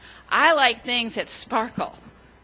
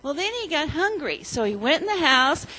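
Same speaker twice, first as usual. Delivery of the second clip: put-on storytelling to children; 5 dB louder than usual. A narrator's reading. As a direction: tell it like a children's story, leaning on each gold. Children's story told in church.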